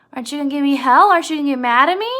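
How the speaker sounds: loud, rising questions; Lenny questioning. Retold or imitated. Lenny expecting George's anger, loud and insistent. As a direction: imitate Lenny asking for a scolding, pitch climbing. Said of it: "And Lenny says".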